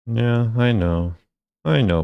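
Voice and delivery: ordinary talk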